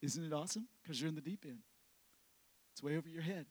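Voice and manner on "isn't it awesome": usual speaking voice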